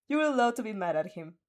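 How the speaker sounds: plain reading voice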